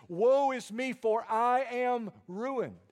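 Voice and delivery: high, loud, slow lament; wailing confession; anguished cry. Retold or imitated. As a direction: cry out in ruin. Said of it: "He says".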